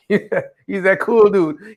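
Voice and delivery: slow, stretched, laughing; drawn out with a laugh. Real, not acted. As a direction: savor each word, chuckling. Praising friend, repeated.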